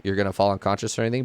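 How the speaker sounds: normal talking voice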